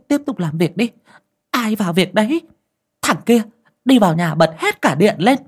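a shaken village aunt taking charge with scolding; bossy old aunt. Acted. Aunt bossing the crowd around.

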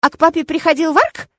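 high, loud, rising question; little boy buzzing with interest; excited childlike curiosity; eager child. A narrator's reading. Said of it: "оживился Арго".

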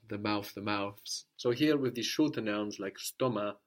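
ordinary talk, nothing marked

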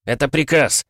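high, firm command; final, forceful cry; shouted order. A narrator's reading.

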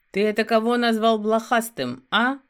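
loud gruff werewolf challenge, a different voice; the werewolf bristles at the insult, loud and menacing; angry werewolf. Acted. Werewolf confronts the friend who insulted him, angry.

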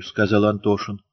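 ordinary delivery

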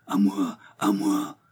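a faint, failing plea; weakened cry for help. A narrator's reading. Prisoner calls out to anyone, weakened. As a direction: call for help with little strength left. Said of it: "répéta la voix plus affaiblie".

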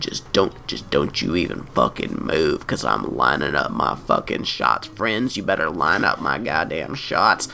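low, menacing hitman voice; sinister low gunman. Pretend. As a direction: growl it like a killer. Sinister gunman playing the lyric.

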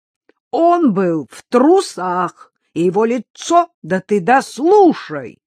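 loud, drawn-out exasperated cry; shouting over her. A narrator's reading. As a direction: cry out to be heard. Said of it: "крикнула Сухих".

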